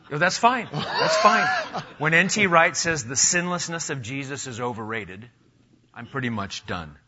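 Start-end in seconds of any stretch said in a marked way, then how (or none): 0.1-5.3 laughing. Real, not acted. Host laughs at curt answer.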